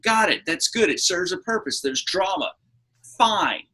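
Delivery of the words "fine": ordinary delivery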